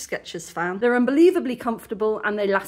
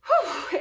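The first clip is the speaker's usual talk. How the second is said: real winded exhale, pitched up; puffed "whew"; 7 semitones higher than usual. Real, not acted. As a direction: blow out a tired, overheated breath. Puffed from heat and chores.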